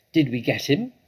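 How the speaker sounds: a farmer hoping he hit; eager farmer Bean. Acted. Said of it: "said Bean".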